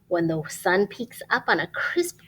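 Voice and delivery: ordinary delivery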